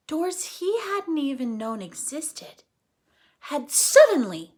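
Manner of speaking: lively read-aloud for kids, voicing each character; animated storytime reading; story narration.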